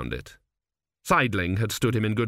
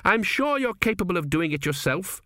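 higher-voiced queen, sourly dismissive; 7 semitones higher than usual. A narrator's reading. Queen brushes off her fussing official, sour. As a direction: dismiss him with sour sarcasm. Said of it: "she added sourly".

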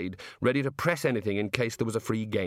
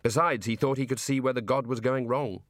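ordinary delivery

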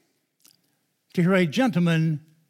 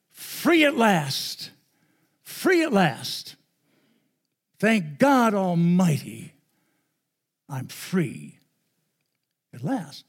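slow, rising preacher's cadence; Martin Luther King; 2 semitones higher, much slower than usual. Retold or imitated. Quoting the famous speech to the church, slow and soaring. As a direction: stretch each phrase like a great orator. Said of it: "He said these words".

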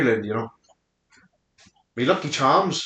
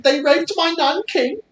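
mock Chinese accent; 11 semitones higher than usual. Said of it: "This is my Chinese accent".

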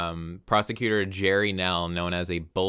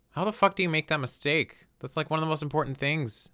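high exasperated disbelief; can't believe the blunder; incredulous; 8 semitones higher than usual. Real, not acted. Incredulous at the detectives.

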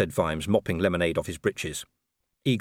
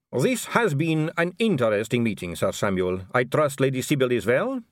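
higher-pitched, formal aristocratic farewell; smooth vampire lady; 7 semitones higher, 4 dB louder than usual. Acted. Vampire politely dismissing Sir Samuel, poised. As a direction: close the meeting with polite poise.

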